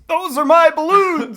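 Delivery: high, loud outraged villain yell; Joker yell. Acted. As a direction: cry out like a hammed-up villain robbed of his toys. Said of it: "when the Joker yells".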